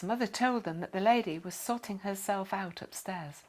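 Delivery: ordinary delivery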